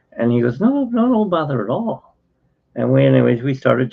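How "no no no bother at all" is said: high-pitched imitation of a fellow patient; friendly dismissal. Patient brushing off her apology, high imitated reassurance. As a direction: wave off the apology in a raised voice.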